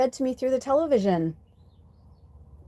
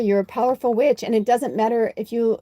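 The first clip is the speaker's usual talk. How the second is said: ordinary delivery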